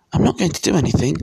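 put-on high, quick boy's voice; fast, high-pitched child; earnest boy. A narrator's reading.

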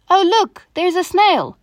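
playful high imitation of mom; imitating mom. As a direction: exclaim it high, like an easily moved mom. Imitating mom moved by trifles.

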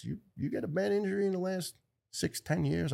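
high, startled question; surprised doctor. Retold or imitated. Doctor reading the scan, surprised by the old fracture. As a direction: voice jumps up in disbelief.

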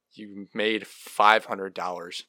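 usual speaking voice

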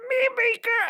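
put-on Mimi girl squealed high and loud; dog baby voice. Shown as a demonstration. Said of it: "It just is like".

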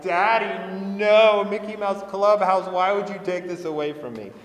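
put-on high, loud whiny toddler wail; crying toddler. Dad mimics his daughter's meltdown over Mickey Mouse, loud whiny wail. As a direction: wail like a two-year-old denied her cartoon. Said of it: "crying, sobbing".

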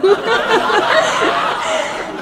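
high bursting giggle; giddy high laugh. Real, not acted. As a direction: let a high giggle escape. Laughing at the prank plan.

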